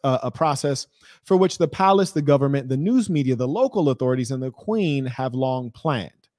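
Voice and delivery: normal talking voice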